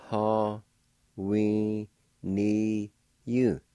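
Ha, we, ni, you said slowly, each syllable apart; careful Cherokee pronunciation. Shown as a demonstration. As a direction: sound out Ha, we, ni, you with pauses. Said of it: "now I'll pronounce his words".

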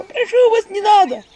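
high, loud desperate begging; pleading squeal. Acted. Imagined victim begging not to be hurt, squealing. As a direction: beg for mercy in a squeal.

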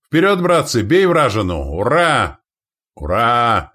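loud, high rallying charge cry; roared battle cry. Acted. Warriors charging the enemy, roared battle cry. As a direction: roar them into the attack. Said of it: "рявкнули клич воины".

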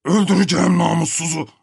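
high, put-on furious voice; enraged threat. Enraged threat from behind bathroom door. (öfkeyle tehdit savurarak)

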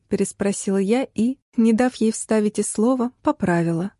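ordinary delivery

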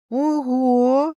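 high, drawn-out exclamation; amazed. A narrator's reading. Heroine sees the familiar blocked by magic, amazed. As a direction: marvel out loud, voice lifted.

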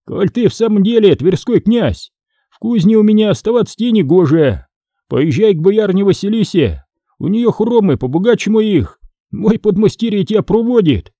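high-pitched, lightly acted smith; Фома, независимый кузнец, спокойно дерзит князю. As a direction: send the prince elsewhere, unawed. (независимо, повышенным голосом)

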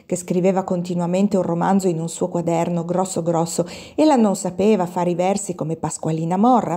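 usual speaking voice

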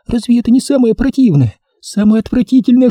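high, anguished female voice; fearful woman, voiced not whispered; frightened. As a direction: confess in high-pitched horror. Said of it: "Голос превратился в испуганный шёпот".

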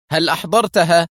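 intense interest. A narrator's reading. Foster questioning Dani on his arrival, eagerly. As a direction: demand the news eagerly. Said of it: "في اهتمام بالغ".

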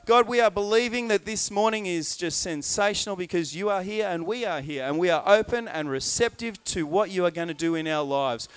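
ordinary talk

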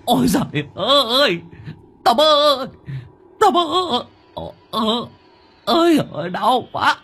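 high, loud wailing cry of pain; howl of pain. A narrator's reading. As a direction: howl as the knife hits. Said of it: "miệng rú lên". (cậu Sáu rú lên vì đau)

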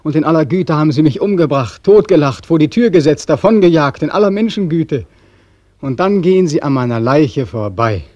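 high, loud and fast bitter tirade; bitter shouting. Acted. Beckmann mocking human kindness that killed him, loud and bitter. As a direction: spit the word gut back in scorn, shouting.

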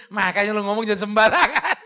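loud teasing through laughter; laughing tease. Real, not acted. Teacher ribbing his colleague after the rebuke, laughing loud. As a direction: laugh while ribbing a friend.

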